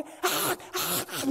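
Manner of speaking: high imitated sobbing bursts; exaggerated wails, one after another; loud mock wailing. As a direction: act out a sudden crying outburst. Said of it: "이러니까".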